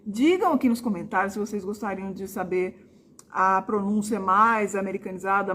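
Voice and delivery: ordinary talk, nothing marked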